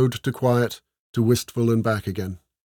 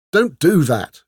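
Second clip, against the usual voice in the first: raised, louder command from the lord's daughter; sharp order; 5 semitones higher, 6 dB louder, slower than usual. A narrator's reading. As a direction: order the simpering men to stop. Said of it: "Esmeralda ordered".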